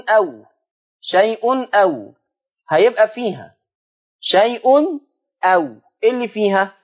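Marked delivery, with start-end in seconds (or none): none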